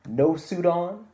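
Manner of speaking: ordinary delivery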